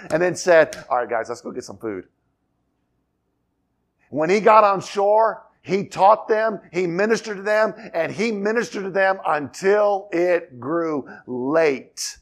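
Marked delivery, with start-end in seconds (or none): 0.9-2.1 quick, low, casual throwaway; mock offhand. Imagined Jesus wrapping up with the disciples, mock offhand. 9.2-12.2 UNTIL IT GREW LATE slowed right down; emphatic phrasing. Shown as a demonstration. Preacher stressing how long Jesus ministered, "until it grew late" drawn out.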